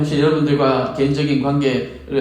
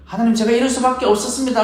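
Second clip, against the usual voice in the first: higher pitched, explaining yourself to God; defensive excuse; 6 semitones higher, faster than usual. Retold or imitated. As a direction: plead your case, a little indignant. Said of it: "라는 설명이 아니라". (높은 톤으로 변명하듯)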